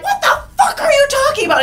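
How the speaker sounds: high, fast furious outburst; livid outburst. Retold or imitated. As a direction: explode at her in disbelief. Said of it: "And he was livid".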